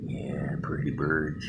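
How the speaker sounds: soft slow whisper. Real, not acted. Man whispering to visiting birds so they stay.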